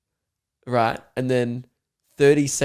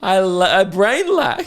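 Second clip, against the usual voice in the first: higher, louder, slow mock-dumb "I brain lack"; mock dopey friend; 7 semitones higher, 6 dB louder, slower than usual. Re-performs the friend's dopey line.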